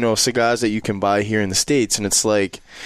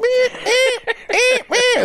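very high squeaky bird cries; chirping bird person; much slower than usual. Retold or imitated. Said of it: "He's like".

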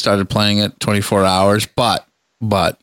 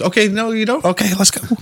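mock high voice; 12 semitones higher than usual. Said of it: "Just like you're like".

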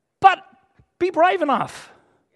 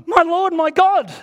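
higher and louder, struck with wonder; awed exclamation; 5 semitones higher, 5 dB louder than usual. Retold or imitated. Thomas meets risen Jesus, loud awed exclamation. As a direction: cry it out as if seeing him alive. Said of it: "Thomas sees him and goes".

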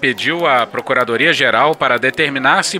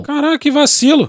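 raging gamer; 13 semitones higher, 3 dB louder than usual. Pretend. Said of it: "achei que tu tava puto".

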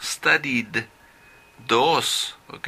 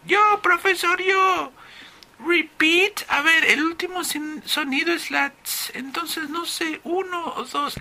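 very high falsetto pupil voice; eager student. Acted. Pupil volunteers an answer to the professor, high put-on student voice. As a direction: put on a squeaky, puzzled student.

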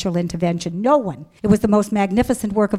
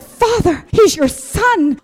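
high, loud, drawn-out exclamation; excited cry to God; 9 semitones higher, 5 dB louder, slower than usual. Retold or imitated. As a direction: burst out to God in amazement, re-enacted. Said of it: "I was so excited".

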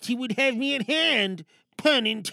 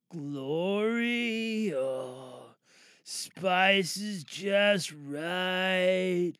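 dreamy food rapture; 5 semitones lower, 5 dB quieter, much slower than usual. Acted.

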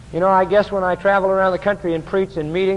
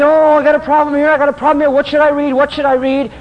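mild mock worry of a troubled believer; fretful asker; 7 semitones higher than usual. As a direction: fuss like a panicked churchgoer. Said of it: "they say".

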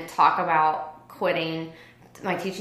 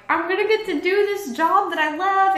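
much higher, dreamy and glowing; hopeful gush; 11 semitones higher, 3 dB louder than usual. Retold or imitated. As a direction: let the joy lift the line. Hopeful answer to friend.

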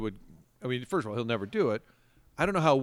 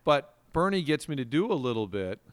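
ordinary talk

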